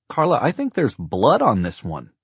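high, loud, swooping call; alarmed calling. A narrator's reading. Husband calls to wife about blood on phone, alarmed. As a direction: call out an unsettling find.

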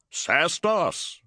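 crying out. Acted. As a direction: call out from above. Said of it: "cried someone above him".

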